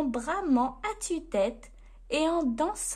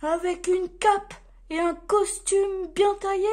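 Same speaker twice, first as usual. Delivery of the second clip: raised, louder Santa voice; Père Noël; 5 semitones higher, 5 dB louder than usual. A narrator's reading. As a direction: keep musing aloud, one item after another. Santa keeps listing requirements.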